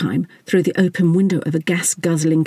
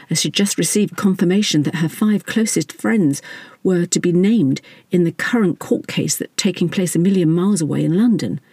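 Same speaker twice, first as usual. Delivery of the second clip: usual speaking voice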